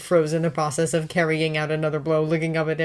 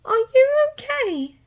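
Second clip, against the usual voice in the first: gentle concern; 3 dB louder, slower than usual. A narrator's reading. Hermione gently checks.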